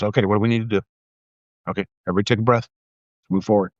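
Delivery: imitating an unbothered operator, low and even; calm low voice, nothing bothers him; stoic. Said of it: "most stoic motherfucker I've ever met".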